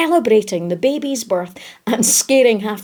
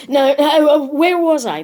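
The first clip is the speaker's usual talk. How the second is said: higher, hesitant spider voice; flustered old storyteller; 5 semitones higher than usual. A narrator's reading. The spider picking up his story after a fall, flustered. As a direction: gather yourself, a little flustered.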